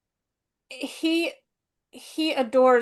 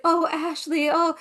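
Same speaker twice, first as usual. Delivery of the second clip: mock damsel; 5 semitones higher than usual. Mocking Scarlett going to Ashley.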